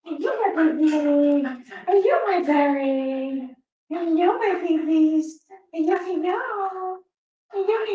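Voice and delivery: cooing pet talk, slow and sing-song; baby talk. Acted. Owner cooing to the little dog, sing-song baby talk. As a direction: coo at the puppy like it's a baby.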